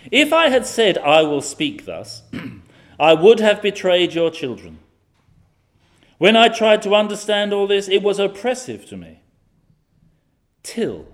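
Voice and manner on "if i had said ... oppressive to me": loud, ringing Bible reading; scripture read with force. Acted. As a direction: proclaim the psalm to the congregation.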